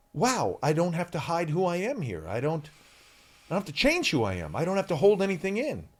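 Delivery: ordinary delivery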